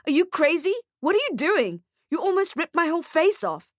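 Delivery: high, indignant protest; high-pitched aggrieved outburst; pained outrage. Acted. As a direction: protest in shock, still smarting. Man outraged after beard is yanked.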